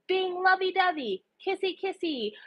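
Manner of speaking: mock lovey-dovey. Narrator mocking a smitten couple, lovey-dovey. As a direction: tease them in a high, cutesy voice.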